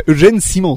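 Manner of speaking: "Eugène Simon" said the French way, see-MON with nasal ending; French pronunciation. Shown as a demonstration. Guest playfully correcting the host, very French pronunciation. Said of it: "Avec un accent très français".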